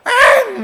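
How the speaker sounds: put-on sudden high whiny baby wail; baby's cry. Preacher imitating a baby crying when put down, high wail. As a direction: mimic a baby's squall when laid down.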